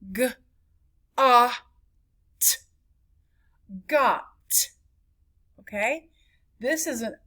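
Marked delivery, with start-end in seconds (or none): 0.1-2.6 G, ah, t as separate letter sounds, short O; sounded out. Shown as a demonstration. Teacher showing how it sounds, sounded out. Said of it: "it sounds like this". 3.9-4.3 got with a short ah and crisp final t; blended short vowel. Shown as a demonstration. Teacher blending the closed syllable, short vowel. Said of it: "it sounds like this".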